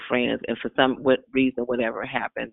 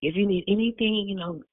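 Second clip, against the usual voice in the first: quoting pastor; 7 semitones higher than usual. Retold or imitated. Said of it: "my pastor would call me Costco".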